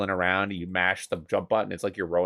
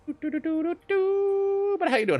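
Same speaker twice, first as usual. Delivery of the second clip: sing-song greeting; much slower than usual. Real, not acted. Greeting a chat viewer.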